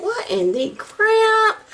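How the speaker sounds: high, drawn-out baffled question; mock disbelief. Imagining viewers reacting to weird ingredients, mock disbelief. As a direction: stretch it out in exaggerated bewilderment. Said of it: "You're gonna think".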